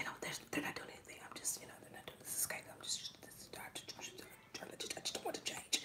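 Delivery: hushed, fast mumbling excuses; a quiet, rushed imitation of someone making excuses; mock inner excuses. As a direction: mutter the excuses under your breath. Voicing the client's excuses.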